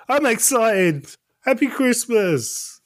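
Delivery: high, sudden fake cheer; mock excited. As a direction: squeal it out brightly.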